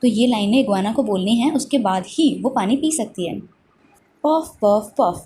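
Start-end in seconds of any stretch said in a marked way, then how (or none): 4.3-5.2 short breathy puffs of a tired lizard; mock gasping puffs. Iguana gasping for breath at the water, mock puffs. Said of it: "gasped the iguana".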